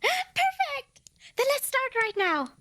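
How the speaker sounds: gasp, then a very high delighted cry; a thrilled gasp as the deal is sealed; overjoyed. Acted. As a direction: burst with joy at the yes.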